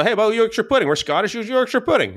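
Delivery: calling out high from the next room; playful high-pitched call to another room; retold yell. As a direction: shout it across the house to your son. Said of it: "And I just yell".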